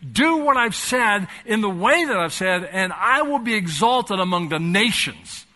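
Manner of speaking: high, loud, urgent pulpit cry; impassioned preaching. Acted. Preacher urges congregation to be unified, impassioned preaching. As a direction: drive each phrase home at full force. Preaches the passage at full force.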